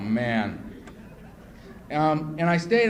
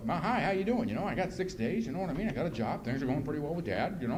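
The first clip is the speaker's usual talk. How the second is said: soft, bobbing singsong prattle; lilting imitation of small talk; chatty newcomer; 5 dB quieter than usual.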